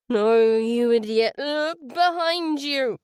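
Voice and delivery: slurred panto shout, slow; drunken slur. Acted. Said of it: "Jack slurred like a drunk at a panto".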